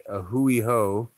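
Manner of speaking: A hui hou said slowly and carefully; Hawaiian A hui hou. Shown as a demonstration. Teaching viewers Hawaiian goodbye, slow careful A hui hou. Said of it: "you say".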